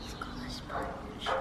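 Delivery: hushed spy whisper. Real, not acted. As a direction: whisper it behind the glass. Spying through the glass.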